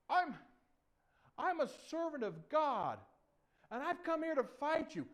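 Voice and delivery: high, loud, halting small voice; little David. Acted. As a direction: pipe up bravely in a high voice. Little David answers the giant.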